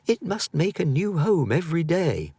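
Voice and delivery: a lightly voiced boy puzzling it out; boy's voice. A narrator's reading. Lightly voiced boy talking to himself in the forest. Boy speaking after running.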